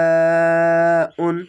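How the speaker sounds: আহিয়াউন sung slowly and loud, the ya held long; Quranic recitation. Shown as a demonstration. Teacher demonstrates the long madd, loud and chanted. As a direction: draw out আহিয়াউন, four beats on the long vowel. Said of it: "চার আলিফ টেনে পড়বেন".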